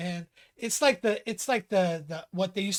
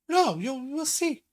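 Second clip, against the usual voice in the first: higher-pitched mock mom dodging the question; mother stalling; 7 semitones higher than usual. A son imitates his mother stalling, in a high mock voice. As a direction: play Mom putting off a yes, pitched up. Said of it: "And then other times she'd just go".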